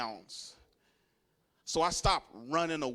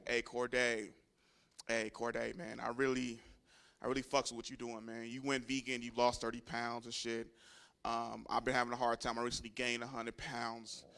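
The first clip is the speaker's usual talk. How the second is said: lower, much softer pleading cousin; sheepish cousin; 6 semitones lower, 9 dB quieter than usual. Retold or imitated. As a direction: ask for help quietly, embarrassed. Heavy cousin asks for vegan help.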